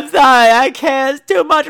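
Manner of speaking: high, loud mock-weepy apology; imitating crying. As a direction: ham up a blubbering guest. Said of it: "He'd be like".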